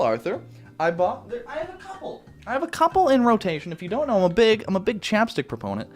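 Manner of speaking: ordinary delivery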